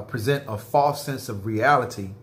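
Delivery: ordinary delivery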